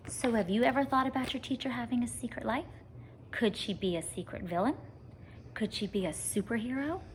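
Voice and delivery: plain reading voice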